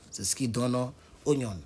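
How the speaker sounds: ordinary talk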